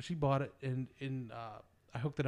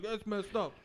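imitating wife; 8 semitones higher, slower than usual. Wife's reply voiced in story.

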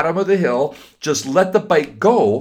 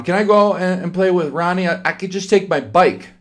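put-on higher, eager child voice; young boy asking; 6 semitones higher, faster than usual. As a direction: plead sweetly like a little kid. Child Jack asks mom.

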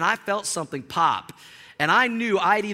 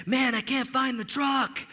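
lost friend; 5 semitones higher than usual. Retold or imitated.

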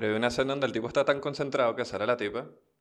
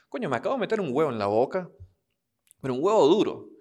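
mock coworker; 4 semitones higher, 4 dB louder than usual. Coworker's innuendo quote.

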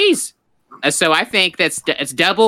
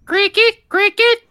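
high chirpy call. Acted. Calling out like a cricket chirp.

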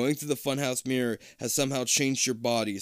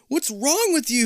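high, startled gasp; 13 semitones higher, 5 dB louder than usual. Acted. Kid startled by friend's shrunken head, gasping in alarm. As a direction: blurt it out in shock. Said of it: "you gasp".

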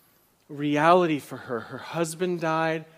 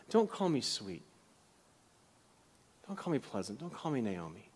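bitter plea; 4 semitones lower, 9 dB quieter than usual. Acted. Preacher voicing Naomi's bitter complaint.

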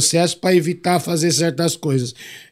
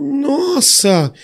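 mock shocked cry, pitched high and drawn out; stretched, high-pitched mock dismay; shocked exclamation; 10 semitones higher than usual. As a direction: imitate an appalled reaction, voice swooping up. Retold girl's shocked reaction to him.

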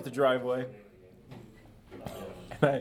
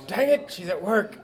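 ordinary talk, nothing marked

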